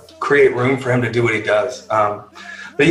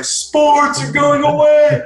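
high-pitched, drawn-out comic wail; mock despair; slower than usual. Sports geek lamenting the shutdown, in mock despair. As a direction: wail it out slowly in hammed-up distress.

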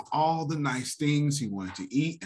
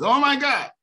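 higher and louder, a mock panicked cry; 12 semitones higher, 6 dB louder than usual. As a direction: mimic someone panicking over the economy. Mocking panicked listeners.

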